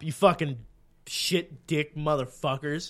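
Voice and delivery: ordinary talk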